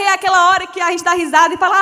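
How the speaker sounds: usual speaking voice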